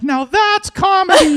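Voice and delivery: high, slow, loud heckler shout; mosh-pit crowd yell. Retold or imitated. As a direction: imitate a roaring festival crowd. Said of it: "I would hear people in this mosh pit going".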